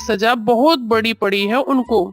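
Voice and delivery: villainous kite, gloating. Acted. (धमकाती चील की नीची आवाज़)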